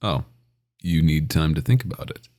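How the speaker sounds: ordinary talk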